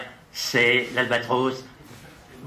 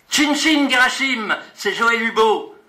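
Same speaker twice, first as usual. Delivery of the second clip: emphatic; 8 semitones higher, 7 dB louder, slower than usual. Acted. Poem list rises to peak.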